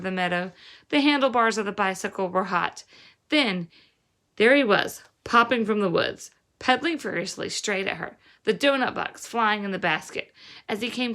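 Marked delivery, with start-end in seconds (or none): none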